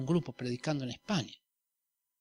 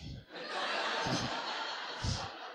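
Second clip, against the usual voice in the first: soft laugh; 4 semitones lower, 10 dB quieter than usual. Real, not acted. Laughing after "Fuera Satanás.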